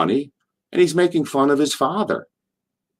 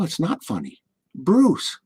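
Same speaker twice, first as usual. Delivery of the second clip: higher, hushed reproach; quiet, pitched-up disapproval; channeling his dad; 6 semitones higher, 4 dB quieter, slower than usual. Retold or imitated. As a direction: admonish softly like a father. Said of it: "That's my dad channel in me here".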